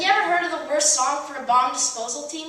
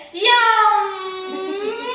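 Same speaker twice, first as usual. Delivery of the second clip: mock delighted yum; 6 semitones higher, 4 dB louder than usual. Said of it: "as they always say".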